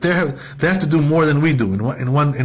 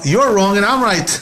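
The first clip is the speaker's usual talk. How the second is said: higher, louder, mock-defiant to God; a teacher mimics Avimelech defying God, higher and louder; defiant retort; 6 semitones higher, 3 dB louder than usual. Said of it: "He was saying, you know".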